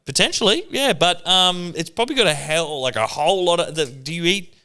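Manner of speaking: high, hesitant doctor imitation; mimicking doctor. Doctor asking Scotty about his diet, in a high mocking voice. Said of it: "They're like".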